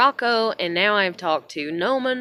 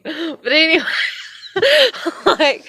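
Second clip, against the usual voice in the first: high, breaking into a laugh; real giggly aside; 7 semitones higher, 3 dB louder than usual. Real, not acted. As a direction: brush it off through laughter. Laughs at own admission of hate.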